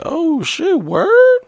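squeaky high, surprised and delighted. Retold or imitated. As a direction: jump way up in pitch in disbelief. Retold delighted reaction to free offer.

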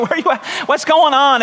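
shouting at God in mock despair; exasperated shout. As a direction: demand an answer from God. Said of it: "I was like".

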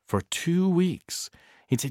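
plain reading voice

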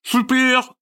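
cheering through a mouthful; super with bread in her mouth; mouth full. A narrator's reading. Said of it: "dit-elle la bouche pleine". (super, la bouche pleine)

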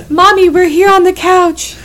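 put-on high-pitched little kid answering a parent; imitated child. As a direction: mimic a kid calling back to mommy. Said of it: "the kids are".

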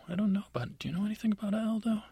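suspicious elf. Acted. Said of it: "the elves look at each other like".

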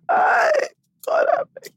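very high-pitched wailing plea; hammed-up squeal of dread; mock despair. Child hearing grandmother's reproach, mock despairing wail.

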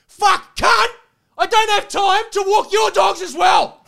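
full-volume, high-pitched angry yell; furious shout. Retold or imitated. Dog walker flipping out at the owner on his call, furious shout.

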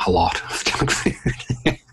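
whispered, laughing aside. Real, not acted. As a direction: laugh it out under your breath. Laughing repeat of junk food.

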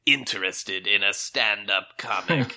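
sinister boogeyman, gloating. Acted. Boogeyman voice held.

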